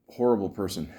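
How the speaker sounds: ordinary talk, nothing marked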